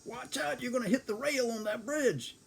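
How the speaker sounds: high, anxious warning about the rail; a frightened passenger's high cry; scared warning. Retold or imitated. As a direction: warn him, voice pitched high with fear. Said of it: "getting really scared".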